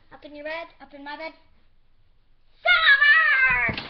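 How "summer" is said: high, loud, stretched shout; calling out. Acted. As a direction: holler to find someone out of sight. Calling out for missing Summer.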